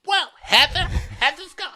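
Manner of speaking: very high, laughing mock wail; mock dread. Hosts mocking guests dreading Heather, high laughing wail.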